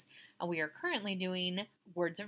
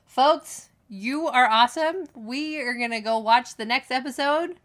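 loud sign-off; 3 semitones higher, 12 dB louder than usual. Real, not acted. Signing off to listeners.

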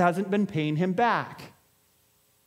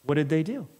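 normal talking voice

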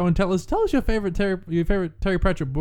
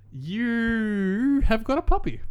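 slow teasing; 6 semitones higher, much slower than usual. Pretend. Teasing drawn-out guess.

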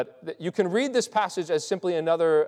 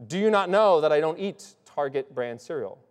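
"Do you not know" pressed hard, tone falling on "know"; mock emphatic. Shown as a demonstration. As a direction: turn a trivial line into a grave declaration. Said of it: "makes it a, a, an important statement".

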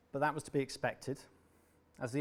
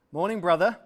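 mocking greeting; 5 semitones higher, 11 dB louder than usual. Mocking older brother at breakfast.

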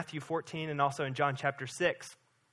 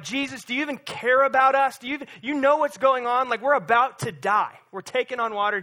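raised, louder frantic plea; panicked disciples; 5 semitones higher, 5 dB louder than usual. Retold or imitated. Disciples shouting at sleeping Jesus in the storm, mock panic. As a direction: cry out as if the boat is sinking. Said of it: "They're freaking out".

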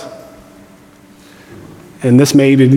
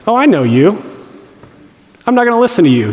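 defiant dismissal; 8 semitones higher, faster than usual. Pretend. Talking back to inner critic.

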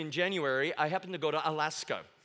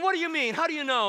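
indignant; 10 semitones higher, 6 dB louder, faster than usual. Retold or imitated. Hunters demand explanation.

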